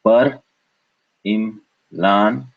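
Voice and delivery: "par, im, lan" as separate beats; alternative syllable split. Shown as a demonstration. Teacher showing another split, "par, im, lan" syllable by syllable. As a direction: pause after "par" and "im".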